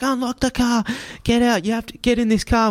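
high, pushy yelled order; mock angry demand. Driver ordering passengers out of the car, mock angry. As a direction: order them out, hammed-up.

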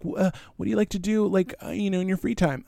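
high, quick, hesitant small talk; acting herself on dates. Dater making small talk with a match, high and hesitant. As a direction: fumble out a polite question in a high voice. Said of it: "I'm always like".

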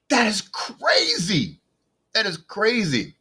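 high, amazed exclamation; excited. Real, not acted. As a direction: draw it out in disbelief. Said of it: "see, I'm excited".

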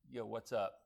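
low, soft, offhand greeting; laid-back biker hello, understated; playing it cool. Biker greeting a fellow rider, casually cool. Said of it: "you're cool just like me".

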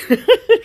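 very high, loud real laugh; burst of laughter. Real, not acted. Laughing at her own breakup text, loud high laugh. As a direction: let out a sudden high cackle.

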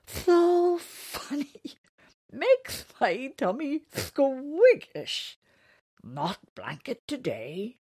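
high, loud and slow amused; giggly creature voice. Acted. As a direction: laugh through the words slowly. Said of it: "he said".

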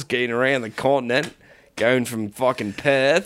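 put-on Australian bloke; Australian accent. Bloke weighs war against home, mock Australian accent. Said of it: "Australian different things".

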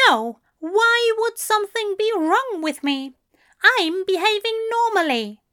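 high-pitched, huffy denial; defensive, high-voiced angel; sniffy. A narrator's reading. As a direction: protest indignantly in a high voice. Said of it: "The angel sniffed".

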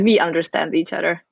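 ordinary talk

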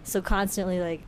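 ordinary talk

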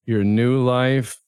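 slow, weary announcer drone; bored PA announcement. Acted. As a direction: drag it out like a tired recording. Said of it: "he's had to say this every thirty minutes".

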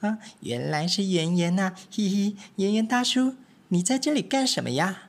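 high, lively little mouse voices; little mice. Acted. Three little mice chattering to each other, high and eager.

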